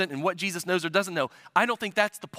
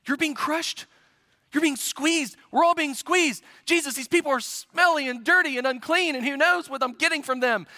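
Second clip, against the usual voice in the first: high, exasperated protest that keeps piling up; desperate condescension; 8 semitones higher than usual. Retold or imitated. Peter complaining to Jesus about the pressing crowd, exasperated. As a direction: complain in a raised pitch, eye-rolling and desperate. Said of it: "You hear the desperation in his voice".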